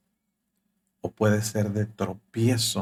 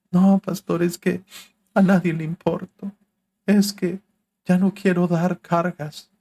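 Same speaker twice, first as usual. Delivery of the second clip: higher and louder, a hammed-up self-pitying wail; self-pitying complaint; 8 semitones higher, 6 dB louder than usual. A church member whining to the pastor, in mock self-pity. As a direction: play the sulking churchgoer who feels nobody cares. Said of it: "pura fiesta de autocompasión y lástima".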